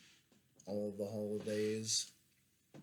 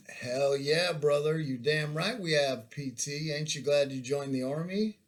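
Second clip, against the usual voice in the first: loud hollering bunkmate; 5 semitones higher, 6 dB louder than usual. Retold or imitated.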